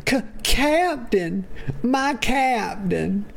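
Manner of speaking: hammed-up devoted sidekick. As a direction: stretch it out like a dramatic hammy tribute. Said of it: "Galen says".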